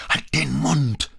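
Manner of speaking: slow, high, forceful yell; angry shout. A narrator's reading. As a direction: shout her down. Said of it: "schrie Ford sie an". (wütend angeschrien)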